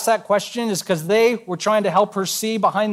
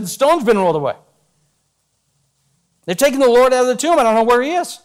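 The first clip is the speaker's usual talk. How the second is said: urgent report; 4 semitones higher, faster than usual. Acted. Mary running to tell Peter and John, urgent. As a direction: rush the news out in a panic.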